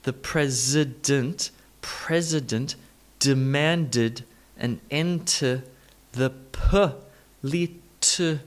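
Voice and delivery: very slow, syllable by syllable, uh in "president" and "political"; schwa demonstration. Shown as a demonstration. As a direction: break "political" into puh-li-tuh-cal. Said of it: "let's add in the schwa sound".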